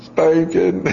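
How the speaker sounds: put-on high, small kid's reply; child answering. Dad voicing his son naming his punishment, high child voice. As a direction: chirp the answer like a two-year-old. Father quizzing toddler son.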